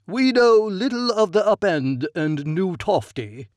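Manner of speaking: a hint of a pompous young nobleman; the Count. A narrator's reading. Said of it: "he replies".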